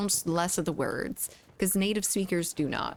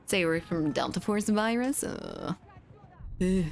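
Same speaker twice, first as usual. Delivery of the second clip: normal talking voice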